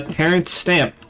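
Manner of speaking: ordinary talk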